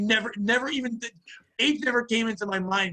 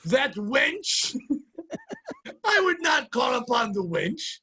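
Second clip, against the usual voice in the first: hammed-up old-timey voice; 6 semitones higher, much slower than usual. Pat protests in a hammed-up Victorian voice.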